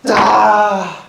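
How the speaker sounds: huge sudden judo yell; kiai shout. Acted. Martial arts student charging the gangster, a huge yell. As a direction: bellow it as you charge the man.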